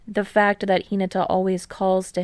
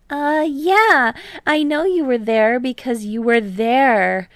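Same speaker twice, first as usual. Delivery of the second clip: put-on higher, louder boyish teammate; Hinata, eager; 5 semitones higher, 6 dB louder than usual. A narrator's reading. Hinata bragging to Kei about deflections, higher, louder and eager. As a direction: bounce up, brag a bit.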